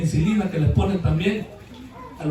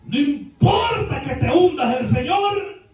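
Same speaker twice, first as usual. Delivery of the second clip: slow, higher shouted encouragement; exhorting shout; 6 semitones higher, slower than usual. Acted. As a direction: call it out to lift them up. Preacher exhorting congregation.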